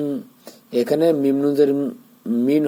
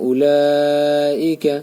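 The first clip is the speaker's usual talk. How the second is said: slow chant with a four-count elongation; Quran recitation; 2 semitones higher, much slower than usual. Shown as a demonstration. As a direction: stretch the vowel long and even. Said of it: "চার আলিফ টান". (চার আলিফ টেনে ধীরে তিলাওয়াত)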